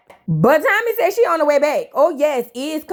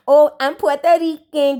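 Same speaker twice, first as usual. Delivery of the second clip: mocking Puerto Rican claim. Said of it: "clear woman who speak of the Espanol".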